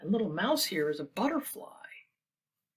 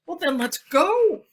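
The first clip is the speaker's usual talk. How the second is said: eager call; 8 semitones higher, 10 dB louder than usual. Acted. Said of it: "Duck quacked".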